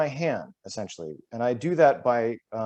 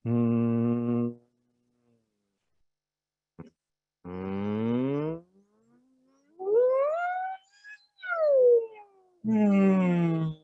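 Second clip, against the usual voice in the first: sustained humming; 8 semitones higher, much slower than usual. Shown as a demonstration. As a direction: hum from the bottom to the top of the range. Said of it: "I go like this".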